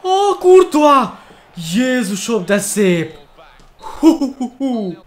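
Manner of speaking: high, slow, loud amazed cry; amazed shout. Real, not acted. Keeper's great save.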